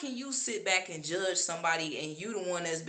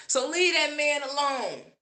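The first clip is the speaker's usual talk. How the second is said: mock shouted advice; 7 semitones higher than usual. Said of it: "quoting their advice".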